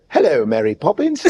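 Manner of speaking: hammed-up mock British accent; bad British accent. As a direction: show off a silly British accent for laughs. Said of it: "watch me do my British accent".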